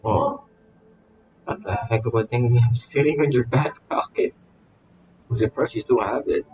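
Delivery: ordinary talk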